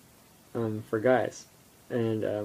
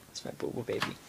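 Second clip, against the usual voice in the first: whispering; 10 dB quieter, faster than usual. Real, not acted. Cooing about dog, unvoiced.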